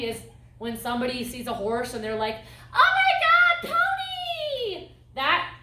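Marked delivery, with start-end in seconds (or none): 2.7-4.9 high shrill gush of excitement, drawn out; shrill excited squeal. Retold or imitated. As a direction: squeal at the pony like an overexcited visitor. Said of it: "overly emotional tones, such as shrill or high pitches".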